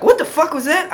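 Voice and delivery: high-pitched startled question; startled neighbor. Retold or imitated. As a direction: imitate a neighbor jolted by a bang. Voicing startled downstairs neighbor.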